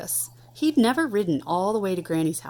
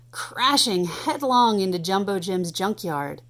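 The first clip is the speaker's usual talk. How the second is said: ordinary delivery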